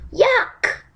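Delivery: high disgusted yuck. Acted. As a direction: squeal it in disgust. Princess disgusted by frog.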